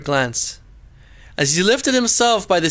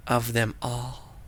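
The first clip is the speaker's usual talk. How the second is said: fading hush; 8 semitones lower, 11 dB quieter, slower than usual. A narrator's reading. Tree falls, fading.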